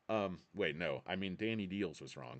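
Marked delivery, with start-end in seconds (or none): none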